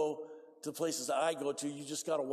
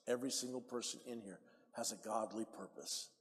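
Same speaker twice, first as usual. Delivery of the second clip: ordinary talk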